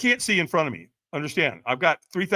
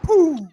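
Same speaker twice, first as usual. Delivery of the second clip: high, loud "pooh" whoosh; launch sound effect; 13 semitones higher, 7 dB louder than usual. Retold or imitated. Firewalker takes off over the coals, explosive whoosh noise. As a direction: imitate shooting off.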